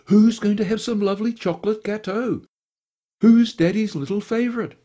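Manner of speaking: put-on high, loud cooing baby talk; doting baby talk. A narrator's reading. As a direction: gush over the little dog like a doting owner. Baby talk to the hidden dog.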